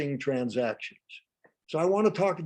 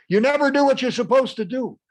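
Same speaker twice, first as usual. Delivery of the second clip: high, loud accusation; angry outburst; 10 semitones higher, 8 dB louder than usual. Acted. Critical parent giving an example, angry shouted accusation. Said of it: "when somebody's quite emotional".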